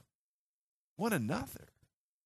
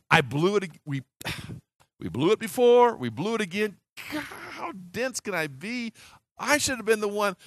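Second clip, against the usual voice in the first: frustrated self-reproach; 8 semitones higher, 5 dB louder than usual. Acted. Disciple voiced regretting aloud.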